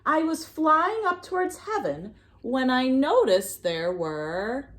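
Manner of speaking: usual speaking voice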